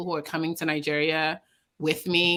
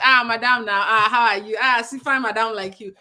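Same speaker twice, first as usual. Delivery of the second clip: flattering, drawn-in Nigerian greeting, louder; airport hustler; 2 semitones higher, 5 dB louder than usual. Retold or imitated. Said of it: "so someone's saying, like".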